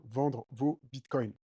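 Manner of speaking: ordinary talk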